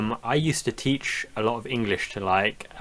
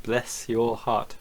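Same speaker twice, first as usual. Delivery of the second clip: savouring the phrase; 3 dB quieter, much slower than usual. Retold or imitated. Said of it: "quite a unique one".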